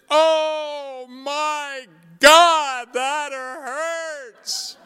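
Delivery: put-on high, loud, slow drawn-out child wail; child's cry of pain. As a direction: wail it like a hurting four-year-old. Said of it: "and she said".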